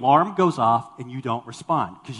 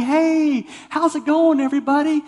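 high, loud, beaming party greeting; oblivious cheerful Harry; 12 semitones higher, 5 dB louder than usual. Retold or imitated. Massive Head Wound Harry greeting guests, high and cheerful. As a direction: greet everyone like nothing's wrong. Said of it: "he's, like, happy".

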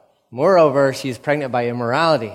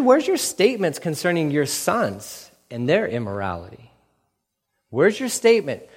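ordinary talk, nothing marked

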